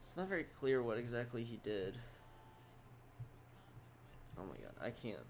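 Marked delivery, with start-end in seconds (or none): 4.4-5.2 sleepy low mumble; fighting off sleep; nodding off. Real, not acted. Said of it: "I'm legit falling asleep".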